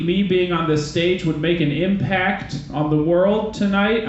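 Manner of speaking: ordinary talk, nothing marked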